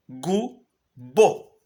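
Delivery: gu then bo, split into two beats; sounding out syllables. Shown as a demonstration. Teacher sounding out gu and bo for students. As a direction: spell out gu, bo syllable by syllable. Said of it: "Então, gubo".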